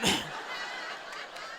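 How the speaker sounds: real little giggle at his own joke; short airless chuckle; soft breathy laugh. Real, not acted. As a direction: let a quiet laugh slip out.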